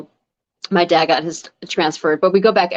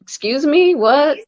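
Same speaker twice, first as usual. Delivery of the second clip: sharp, high, indignant challenge; mock-offended East Coast comeback; feisty; 8 semitones higher, 3 dB louder, slower than usual. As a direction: snap it back like someone's crossed you. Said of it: "you're also just more feisty".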